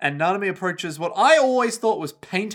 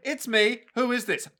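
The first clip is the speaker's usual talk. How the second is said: higher, quick-fire imitation of a phone call; mock chipper caller; 7 semitones higher than usual. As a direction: replay the perky caller in a high voice. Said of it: "a really chipper girl being like".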